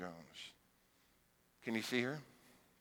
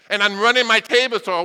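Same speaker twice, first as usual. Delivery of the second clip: usual speaking voice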